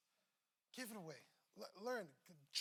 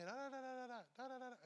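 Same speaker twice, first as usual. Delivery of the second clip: imitating wife's chatter; 5 semitones higher, much faster than usual. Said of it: "my wife's talking".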